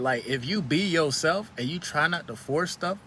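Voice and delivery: usual speaking voice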